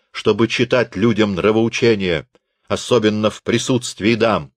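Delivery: toast him with sarcastic grandeur; raising a glass to mock the teacher.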